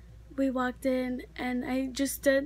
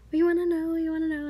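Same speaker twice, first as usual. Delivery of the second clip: higher, louder eager begging; eager retold cousin; 4 semitones higher, 4 dB louder than usual. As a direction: imitate an impatient, eager cousin. Cousin eagerly asking baby's gender.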